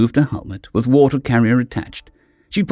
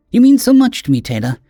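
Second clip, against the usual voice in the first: emotional caring friend; 4 semitones higher than usual. Acted. [name] emotional.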